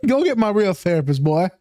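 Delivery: hammed-up Southern put-on; Southern accent. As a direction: boss them about in a caricature Southern accent. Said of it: "Them fucking people from the South".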